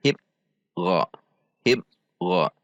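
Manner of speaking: "Hib" cut off hard, pause, then "Gha"; jerked and cut. Shown as a demonstration. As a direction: stop "Hib" dead before a separate "Gha". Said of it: "tidak boleh dihentak". ("Hib", "Gha" dihentak dan diputus)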